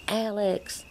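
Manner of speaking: mother calling out to her son across a shop; retold yell. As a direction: call his name across the store. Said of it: "So I just yelled across the store".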